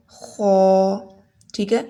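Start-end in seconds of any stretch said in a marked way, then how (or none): 0.1-0.5 خو thick from the top of the throat; heavy kho. Shown as a demonstration. Teacher modelling the heavy letter خو. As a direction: scrape خو heavy like clearing the throat. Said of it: "اور پھر اس کو پڑھیں خو".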